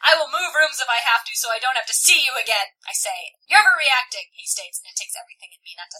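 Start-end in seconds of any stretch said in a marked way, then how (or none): 0.0-2.7 loud, emphatic acted anger; heated final word; fed-up declaration. A narrator's reading. Tessa declares she is done. 3.5-4.3 loud, raised-pitch retort; forceful acted dismissal; heated accusation. A narrator's reading. Harry snaps back in quarrel.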